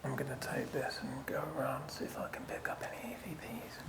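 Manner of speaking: jumpy whispering ghost hunt; hushed whisper in the dark. Real, not acted.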